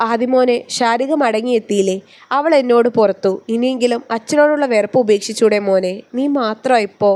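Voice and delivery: ordinary delivery, nothing marked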